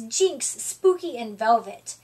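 usual speaking voice

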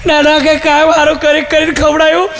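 a lightly hammed-up tearful wife; crying wife. Wife complaining to husband about the neighbour, mock tearful. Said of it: "એને રોવાનું ચાલુ કર્યું".

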